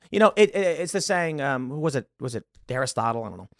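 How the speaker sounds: normal talking voice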